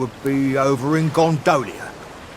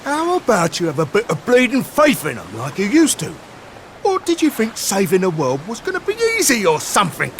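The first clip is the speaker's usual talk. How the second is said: raised, heated telling-off; exasperated scolding; 3 semitones higher than usual. Acted. Companion scolding Sylve for losing faith, exasperated. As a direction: shake a moping friend out of it.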